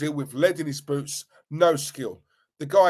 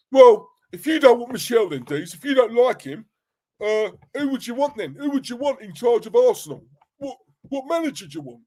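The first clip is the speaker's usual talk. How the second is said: higher, louder put-on voice of a pestering mate; mock fan voice; 6 semitones higher, 5 dB louder than usual. Host imitating his mate John grilling him on the manager, put-on voice. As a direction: ham up a mate's challenge. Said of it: "He don't talk like that, by the way. I'm just saying that for effect".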